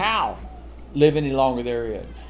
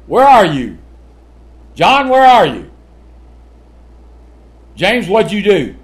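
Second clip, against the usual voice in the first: calling out; 4 semitones higher, 9 dB louder than usual. Retold or imitated. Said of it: "if you go".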